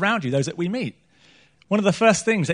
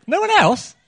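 mock surprise, pitched much higher; teasing high question; 12 semitones higher, 4 dB louder than usual. Real, not acted. Preacher to congregation, playful high question.

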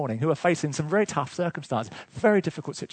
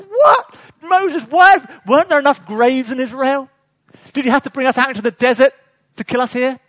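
whining complaint; 7 semitones higher, 10 dB louder than usual. Acted. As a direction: wail it like a grumbling crowd. Israelites complain to Moses.

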